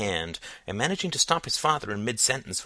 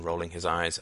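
plain reading voice

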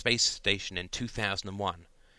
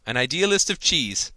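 louder and higher exclamation; crying out; 5 semitones higher, 6 dB louder than usual. Acted. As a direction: call it out in delight. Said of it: "cried Gerald".

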